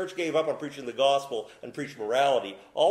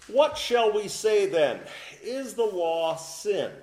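scripture reading; 6 semitones higher, 4 dB louder, slower than usual. Shown as a demonstration. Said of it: "reading scripture aloud emphatically".

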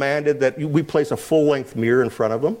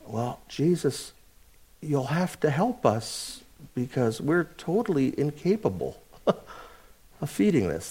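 humble plea; 3 semitones lower, 8 dB quieter than usual. Retold or imitated. Humble response voiced to Jesus.

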